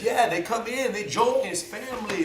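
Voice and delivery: high, lilting mock Portuguese accent; playful put-on Portuguese accent. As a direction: imitate an instructor with a little Portuguese accent. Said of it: "with their little Portuguese accent".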